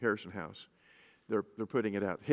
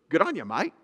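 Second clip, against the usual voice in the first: put-on a light Australian accent, tossed off; a quick, playful Aussie greeting in an Australian accent; 4 semitones higher than usual. Pastor mimics Australians for the congregation, light Australian accent. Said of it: "they talk different in Australia".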